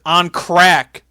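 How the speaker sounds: loud imitated promo punchline; punched-up insult. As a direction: hit the last words hard like a wrestler. Climax of retold promo insult.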